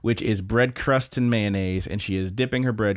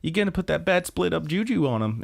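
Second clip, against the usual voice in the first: a light, higher-pitched fussy friend; Kabi's fussy voice; 8 semitones higher than usual. Retold or imitated. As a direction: lightly voice the superstitious friend lecturing. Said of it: "And Kabi's like".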